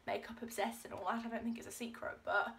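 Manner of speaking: ordinary talk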